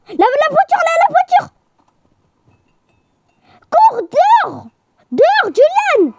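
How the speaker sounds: very high-pitched frantic warning; panicked yelling. Real, not acted. Player urging a friend to run outside from the car, panicked yelling. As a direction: cry out to get them running.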